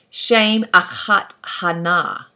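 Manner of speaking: "Shem achat hana" read slowly, word by word, in Hebrew; slow careful Hebrew reading; Hebrew read aloud. Shown as a demonstration. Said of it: "you see above hana another zakiv katon".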